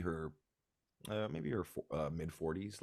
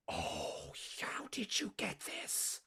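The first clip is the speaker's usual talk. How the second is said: awed whisper; 14 semitones higher, 5 dB quieter, slower than usual. Acted. Mother gasps at gift.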